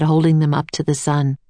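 usual speaking voice

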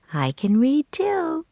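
high, slow bright offer; voice goes up. Acted. Annie offering herself to the man, voice going up. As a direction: lift the voice up high, pleading. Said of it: "Her voice went up".

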